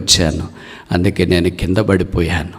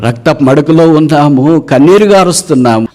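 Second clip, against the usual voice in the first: higher and louder lament; impassioned cry; 7 semitones higher, 6 dB louder than usual. Real, not acted. The preacher mourns the fallen believers, loud and high. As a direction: cry out the suffering to the congregation.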